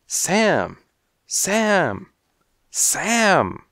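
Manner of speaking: high, loud repeated call of a name. A narrator's reading. Calling out for Sam.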